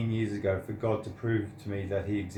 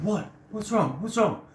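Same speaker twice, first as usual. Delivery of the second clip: much higher, alarmed voices of friends asking; worried friends; 11 semitones higher, 3 dB louder than usual. Retold or imitated. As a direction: mimic friends panicking over you. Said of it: "my friends are going".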